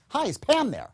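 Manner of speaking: sharp as a tack, full of energy; upbeat enthusiastic. Shown as a demonstration. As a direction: lift the whole line high and lively. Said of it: "the second one comes out upbeat, enthusiastic, sharp as a tack".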